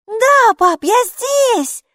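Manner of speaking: put-on high, loud girl's call. A narrator's reading. Girl calling up to her father on the balcony. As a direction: shout up to dad. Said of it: "крикнула Майки".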